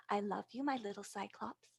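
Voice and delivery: put-on softly loving goodnight to a child; tender. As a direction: soothe the little one to sleep. Mother soothing child at bedtime.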